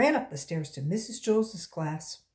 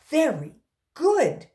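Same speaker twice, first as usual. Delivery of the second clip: loud praise; 8 semitones higher, 9 dB louder, slower than usual. Acted. Teacher praises, much louder.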